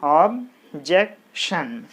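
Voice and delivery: "objection" drawn out very slowly, syllable by syllable; slow syllable count. Shown as a demonstration. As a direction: break "objection" into slow beats. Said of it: "So three syllables are there".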